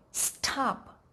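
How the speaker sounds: sharp falling "stop."; a commanding "stop." that drops hard; "stop." cut short with a steep fall; angry fourth tone. Shown as a demonstration. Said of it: "like saying an angry".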